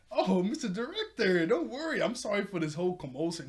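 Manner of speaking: high, loud, hammed-up niceness; happy-go-lucky. Shira placating the director about the commotion, mock happy-go-lucky. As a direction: imitate someone smoothing things over far too cheerily. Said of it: "trying to be all happy-go-lucky".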